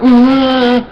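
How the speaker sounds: repeated high loud "Ah" to drown you out; hammed-up yelps cutting someone off; mock yelling over. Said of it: "and they're like".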